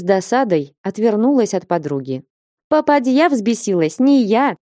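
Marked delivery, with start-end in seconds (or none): 2.7-4.6 higher, playful comeback; teasing and lively, without a laugh; merry retort. A narrator's reading. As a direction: toss it back with mild mirth. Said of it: "захохотала Флёнушка".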